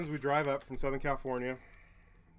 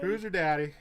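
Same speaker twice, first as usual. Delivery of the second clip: louder, higher teasing chant; louder playful taunt, higher; mock taunt; 6 semitones higher, 7 dB louder than usual. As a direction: crow it playfully.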